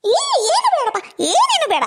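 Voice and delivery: high, stretched-out refusal; emphatic refusal. Acted. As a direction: wave the offer off in a high voice. Mother hastily declines son's offer. (ಎತ್ತರದ ದನಿಯಲ್ಲಿ ಬೇಡವೆನ್ನುವುದು)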